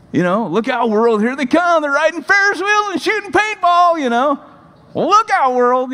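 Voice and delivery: a hammed-up "look out, world" in a high voice; mock hype. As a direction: sarcastically hype them up like a trailer. Mocking the next generation.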